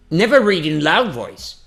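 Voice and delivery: big, projected English line; coming out by itself. Shown as a demonstration. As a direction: let the trained phrase burst out at full voice. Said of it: "sai sozinho".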